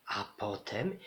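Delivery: soft, quiet lead-in "a potem" with a rising end; quiet "a potem" rising into suspense; hushed pause. A narrator's reading. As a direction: hold "a potem" back, low and soft, rising. Suspense build before the beast.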